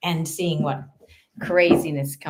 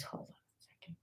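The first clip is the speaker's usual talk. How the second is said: whisper; 26 dB quieter than usual. Real, not acted.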